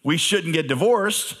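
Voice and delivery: Well wait, smug trap set; mocking Pharisees. Pharisees trying to trap Jesus, high mocking voice. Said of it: "And they're like".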